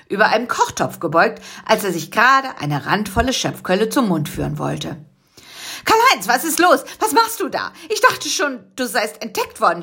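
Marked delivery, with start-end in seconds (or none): 5.9-9.8 scolding. Acted. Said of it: "schimpfte Busy".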